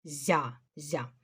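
зя with a soft з, fading off; soft syllable demo. Shown as a demonstration. Teacher repeats зя for pupils. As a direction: say зя softly to show the palatal з. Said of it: "вимовляю зя".

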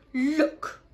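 high, loud exclamation; excited cry. A narrator's reading. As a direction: cry out the discovery. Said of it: "cried Posy".

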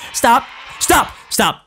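sharp high panicked stop; panicked shout. Real, not acted. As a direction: yell it in sudden alarm. Panicking at game monster.

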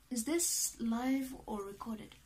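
ordinary talk

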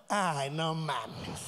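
very slow, low groaned words; drawn-out mock exhaustion; weary complaint. Friend's acted dragged-out complaint.

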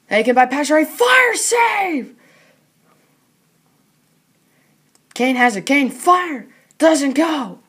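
rapid live hockey play-by-play; frantic mock sports commentary. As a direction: call the game as it happens.

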